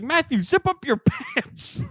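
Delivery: high, loud, slow mock holler; imitating scolding aunt. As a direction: stretch out the aunt's scolding, cracking up at the end. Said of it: "She's like".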